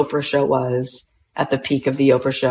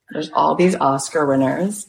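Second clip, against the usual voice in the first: ordinary talk, nothing marked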